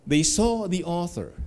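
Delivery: usual speaking voice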